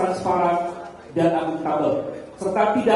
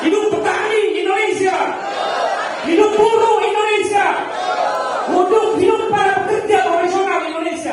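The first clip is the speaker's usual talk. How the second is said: fiery revolutionary speech; 11 semitones higher than usual. Real, not acted. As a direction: lead the crowd in a battle cry. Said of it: "Kita teriakan bersama-sama".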